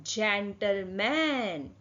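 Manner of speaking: very slow "जेंटलमैन", j at the start; soft G word. Shown as a demonstration. Teacher sounding out a soft G word, slow demonstration. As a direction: stretch out "जेंटलमैन" by syllables. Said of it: "इसको कैसे बोलेंगे".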